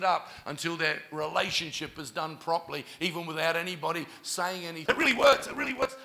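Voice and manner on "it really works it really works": high-pitched excited girl, imitated; retold excited testimony. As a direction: voice her amazed testimony up high. Voicing the transformed girl's testimony.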